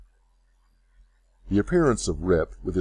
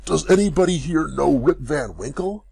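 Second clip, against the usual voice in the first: higher, louder desperate call; despairing cry; 7 semitones higher, 4 dB louder than usual. A narrator's reading. Rip calling to the crowd, crying out in despair. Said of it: "cried out in despair".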